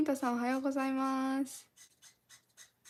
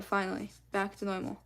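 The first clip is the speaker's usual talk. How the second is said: ordinary talk, nothing marked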